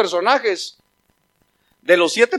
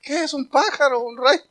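lightly acted higher-pitched puzzlement; puzzled Abraham; 7 semitones higher than usual. Abraham wonders what he just heard, puzzled higher voice. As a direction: play a mildly baffled listener, pitched up. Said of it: "Y que Abraham".

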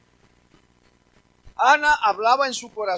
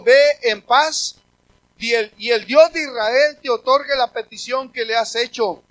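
blessing; 9 dB louder than usual. Acted. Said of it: "Elí respondió y dijo".

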